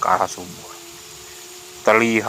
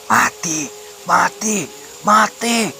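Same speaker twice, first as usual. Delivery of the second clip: high chant, climbing in pitch; eerie high possessed cry; possessed shouting; 11 semitones higher, much slower than usual. A narrator's reading. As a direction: cry out like one possessed, rising each time. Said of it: "teriak Maya sambil tertawa".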